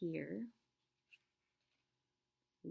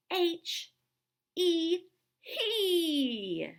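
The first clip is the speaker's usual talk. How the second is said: high, loud flexing shout of each letter; tough muscle voice; 12 semitones higher, 7 dB louder, much slower than usual. Shown as a demonstration. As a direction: pump up your muscles and belt it out. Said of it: "That word is he".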